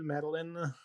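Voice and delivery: Madeline with a short, unstressed "lin" ending; name pronunciation guess. Shown as a demonstration. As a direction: say Madeline so the last syllable reduces to "lun". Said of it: "what's her name".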